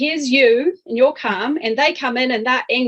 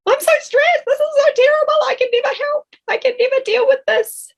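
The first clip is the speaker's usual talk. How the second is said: much higher and louder, frantic; panicky version; 3 dB louder, faster than usual. Shown as a demonstration. Coach demonstrating the wrong, panicked way to self-talk, high and frantic. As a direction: squeal it out in a mock panic.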